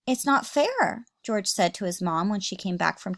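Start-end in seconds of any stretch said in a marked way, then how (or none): 0.1-1.1 higher, slow whine; whiny complaint. Acted. George to his mom, whining. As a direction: drag it out like a sulky kid.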